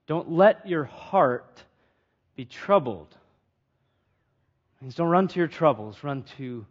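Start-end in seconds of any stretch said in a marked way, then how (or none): 0.1-3.1 "let" leaned on, slow, fading soft on "troubled"; the preacher repeats Jesus's words to the congregation, slow and hushed; slow emphasis. Shown as a demonstration. Drop the voice on "troubled", slow and soft. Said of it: "Notice the emphasis".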